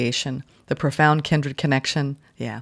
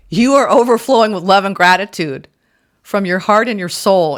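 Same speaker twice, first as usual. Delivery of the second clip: loud, higher, emphatic; gushing; 5 semitones higher, 7 dB louder than usual. Real, not acted. Reader gushing to Scorpio viewers, loud and high. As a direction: pour it out with joy.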